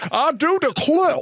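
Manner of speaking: slow, high, showy Southern drawl; southern gentleman accent. Pretend. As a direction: ham up an old Southern gentleman. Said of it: "I was a southern gentleman".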